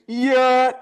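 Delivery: very high, loud bleated yeah; squeaky goat voice. Pretend. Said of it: "I'll say".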